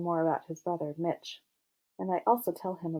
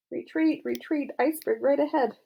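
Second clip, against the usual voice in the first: high-pitched emergency call; mock alarm; 8 semitones higher, 4 dB louder than usual. A narrator's reading. Girl's inner voice after Steve declines, mock panic. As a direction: sound the retreat, high and urgent.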